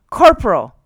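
captain shouting loud for his corporal; cried out. A narrator's reading. Captain summoning his corporal, a loud high cry. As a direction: bark it across the room, loud and high. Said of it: "cried the captain".

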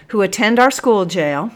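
"school jail" firmly substituted; self-correction. A narrator's reading. Sergeant correcting himself, firm on "school jail". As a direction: restate it, adding "jail" after "school". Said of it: "the sergeant corrected himself".

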